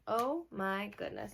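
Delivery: plain reading voice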